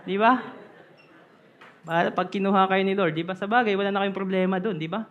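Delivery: ordinary delivery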